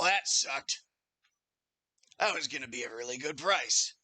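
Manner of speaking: lightly voiced chatty barkeep, lifted on the offer; a touch of an innkeeper's sales patter. Barkeep offering guests two rooms, lightly voiced innkeeper.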